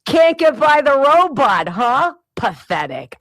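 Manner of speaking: a touch of loud teasing scorn; mocking son. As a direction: needle mom like a smug son. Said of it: "He goes".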